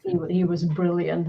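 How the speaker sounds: ordinary delivery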